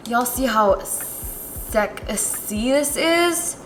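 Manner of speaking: long, drawn-out sultry tease; playfully sultry. Pretend. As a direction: stretch the words out, mock-seductive. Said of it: "That was so sexual for no reason".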